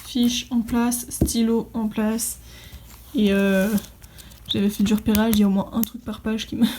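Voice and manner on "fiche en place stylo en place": slow checklist. Real, not acted. Ticking off a setup checklist.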